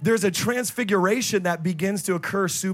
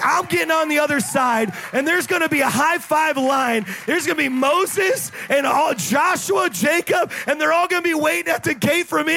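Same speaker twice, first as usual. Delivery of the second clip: loud, higher and racing at the end; excited shout; 8 semitones higher, 4 dB louder than usual. Retold or imitated. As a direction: build the thrill of the welcome line.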